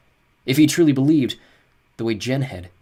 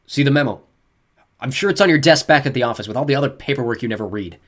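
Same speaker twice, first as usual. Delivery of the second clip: scornful; 4 semitones higher, 7 dB louder than usual. A narrator's reading. Scornful father taunts police.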